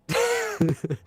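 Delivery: short high-pitched laugh; real high, quick laugh. Real, not acted. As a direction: burst out in a brief high laugh. Laughs at being compared to Clinton.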